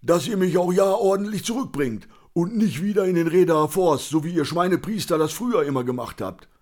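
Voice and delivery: high, loud blustering drinker voice; put-on belligerent big mouth; cantankerous Conny, scolding. A narrator's reading.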